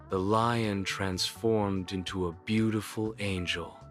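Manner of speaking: ordinary delivery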